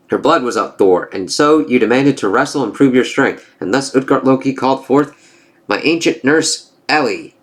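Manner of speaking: lightly voiced gods trading taunts; storybook reading of a Norse comic; comic dialogue read-aloud. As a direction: read the panels with a hint of character.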